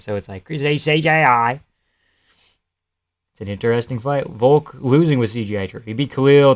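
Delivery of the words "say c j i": a mild mock Australian accent, slow, with "C J I" drawn out; a light Aussie impression of Craig Jones. Streamer imitates how the Australian grappler says it, mild Australian accent. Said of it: "he has the Australian accent".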